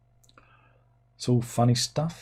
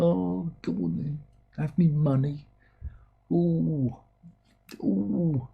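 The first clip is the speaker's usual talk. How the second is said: high put-on voice, giving in; gullible buyer; 6 semitones higher, slower than usual. Fan gives in to the seller, gullible buyer voice. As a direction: cave in with drawn-out mock delight.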